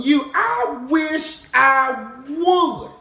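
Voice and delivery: loud, high, outraged no; protesting auntie, high and loud; indignant refusal. Retold or imitated. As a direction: refuse the casket at full volume. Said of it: "she told me something".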